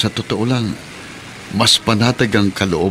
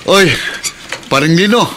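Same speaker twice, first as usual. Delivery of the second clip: much higher, louder hail across the street; calling out; 10 semitones higher, 4 dB louder than usual. Acted. Pedicab driver hails his friend on the street, loud call. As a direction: shout a friend's name to catch him.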